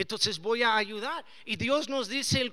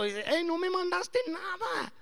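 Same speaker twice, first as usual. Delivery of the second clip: higher, teasing reproach; mock reproach; 9 semitones higher than usual. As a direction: tease him for not sending it. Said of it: "le saludé y luego". (reproche burlón, más agudo)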